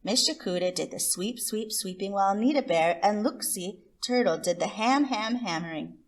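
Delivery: plain reading voice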